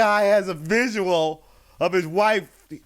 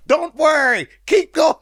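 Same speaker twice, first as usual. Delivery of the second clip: mock captain reassurance; 9 semitones higher, 5 dB louder than usual. Voicing the captain in the joke.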